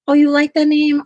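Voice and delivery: light up at the compliment; delighted. Real, not acted. Answering a viewer who likes the name, delighted.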